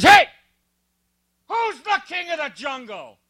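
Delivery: high, puffed-up lion voice; swaggering lion. Pretend. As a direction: strut and demand it like a bully lion. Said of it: "and he says".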